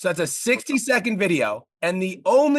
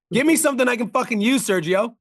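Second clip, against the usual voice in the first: loud and high, hammering it home; frustrated shout; 7 semitones higher, 5 dB louder than usual. Real, not acted. Coach demanding real value from Sergio, raised shout. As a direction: bark it at him to wake him up. Coach roasting client's video.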